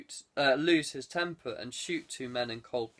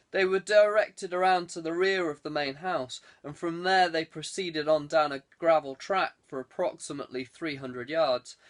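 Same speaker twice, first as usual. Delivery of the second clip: ordinary delivery, nothing marked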